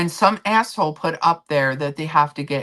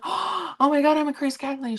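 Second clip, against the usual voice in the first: excited gasp; 11 semitones higher than usual. Retold or imitated. Own excited reaction retold.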